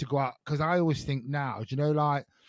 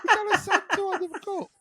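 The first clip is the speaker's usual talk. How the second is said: laughing. Real, not acted. Laughs at the cup memory.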